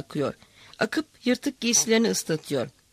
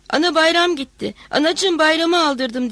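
wailing cry; 7 semitones higher, 6 dB louder than usual. A narrator's reading. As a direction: cry out to her mother in despair. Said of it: "diye bağırdı".

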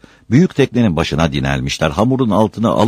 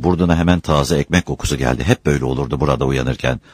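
plain reading voice

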